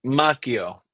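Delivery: "Macchio" with a hard K, as MAH-kee-oh; "Macchio" said the Italian way, MAH-kee-oh; authentic Italian pronunciation. Shown as a demonstration. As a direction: correct the name to "Macchio", cch said as K. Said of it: "use the authentic Italian pronunciation".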